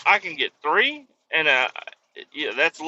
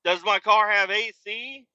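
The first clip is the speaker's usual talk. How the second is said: higher, lightly voiced nosy clerk; mocking clerk; 6 semitones higher than usual. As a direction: mimic the pointless questions. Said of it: "It's like".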